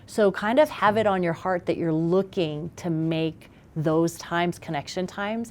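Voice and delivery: ordinary talk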